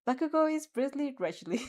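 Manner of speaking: plain reading voice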